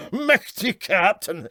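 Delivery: high snort of laughter into an amazed exclaim. A narrator's reading. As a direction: laugh at the captain's ignorance. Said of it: "snorted his amazement".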